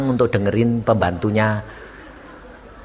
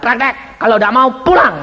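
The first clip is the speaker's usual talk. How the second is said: stern order; 8 semitones higher than usual. Retold or imitated. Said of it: "saya bilang".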